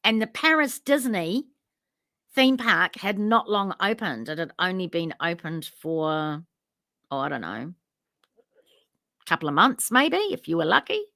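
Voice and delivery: normal talking voice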